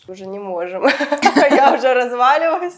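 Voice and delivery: laughing loudly. Real, not acted. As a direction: laugh the words out, worn out. Laughing about being worn out. (смеясь, громко)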